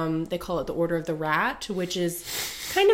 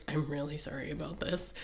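low, much softer mumble; mumbled apology; 4 semitones lower, 12 dB quieter than usual. Retold or imitated. Paladin mumbling an apology to Grace, very soft. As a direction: mutter the apology under the breath. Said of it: "mumbles to her".

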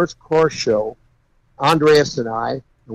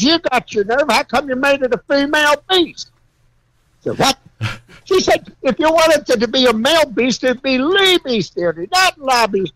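a small woman scolding the car owner, indignant; scolding old woman; 10 semitones higher than usual. Retold or imitated. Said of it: "She says".

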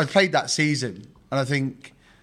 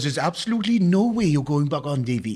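a light attempt at a Welsh accent, doctor's verdict; hint of a Welsh club doctor, quoted. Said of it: "I'd like to do a Welsh accent here".

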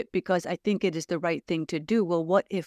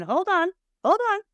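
urgent hold on; 12 semitones higher, 3 dB louder, faster than usual. Real, not acted. Interrupts herself, pitch climbing sharply.

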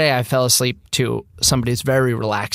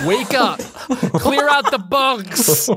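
high shouted drill sergeant wake-up; 12 semitones higher, much slower than usual. Pretend. Waking sleepers, drill-style wake-up call.

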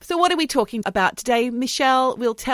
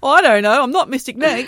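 mock sarcastic retort; 4 semitones higher, 8 dB louder than usual. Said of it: "People would say".

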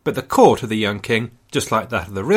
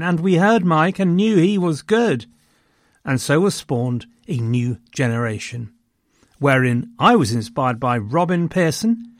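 grave ancient myth telling; 2 semitones higher than usual. Acted. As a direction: tell it like an ancient epic. Mock epic podcast origin tale.

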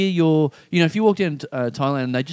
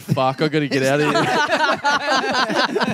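slow, drawn-out, higher mock panic; panicked realisation; 6 semitones higher, much slower than usual. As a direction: drag it out like a man who has to escape. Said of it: "looked within and went".

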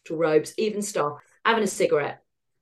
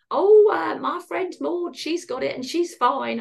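mum's voice; 8 semitones higher, 4 dB louder, slower than usual. Retold or imitated. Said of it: "all my mum said to me was".

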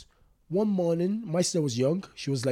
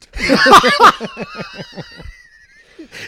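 loud burst of laughter; 17 dB louder than usual. Real, not acted.

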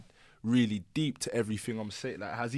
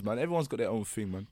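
normal talking voice

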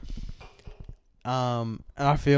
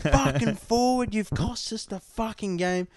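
imitated yell; 8 semitones higher than usual. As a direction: imitate a player hollering blame at the referee. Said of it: "he's yelling at the ref".

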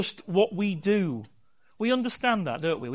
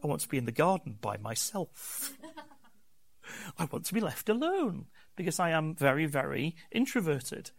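mock introvert reply; 3 semitones lower, 5 dB quieter than usual. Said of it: "Paul is sometimes just like".

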